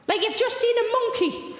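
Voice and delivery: high, eager mock exclamation; wide-eyed excitement. Fella shouting out an emotion, mock eager and high. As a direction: blurt it out like a eureka moment. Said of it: "eyes were really wide and he went".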